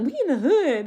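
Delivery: put-on a light high take on the kids' phrasing; mimicking kids' slang. Teacher mimics the students' slang, mildly mocking. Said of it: "Why are we talking like that?".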